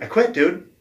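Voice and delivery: retold yell to the boss; insistent quitting. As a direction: dig in and shout the quit.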